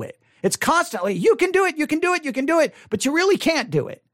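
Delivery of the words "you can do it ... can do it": high-pitched, repetitive pep talk; insistent cheering-on, pitched up; mock encouragement. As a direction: parody a preacher urging people on. Mimics preachers' constant encouragement.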